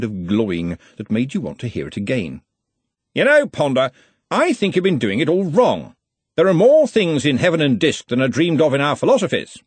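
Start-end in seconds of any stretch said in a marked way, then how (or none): 3.1-9.6 put-on loud, hearty old wizard; booming, confident wizard boss; bluff archchancellor. A narrator's reading. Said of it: "Ridcully voiced in story".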